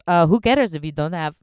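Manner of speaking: loud, blunt mock brother-in-law; impression of brother-in-law. Brother-in-law shrugging off her guilt, loud and blunt. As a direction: bark it like a dismissive relative. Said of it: "do a little impression of him".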